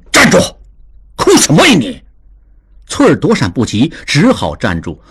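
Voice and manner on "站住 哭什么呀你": a father's sharp barked command, higher; stern shout. A narrator's reading. Father stops weeping maid with a stern shout. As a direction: snap at the crying maid to stop. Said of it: "当下大喝一声".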